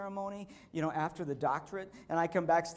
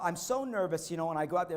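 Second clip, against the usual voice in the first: ordinary delivery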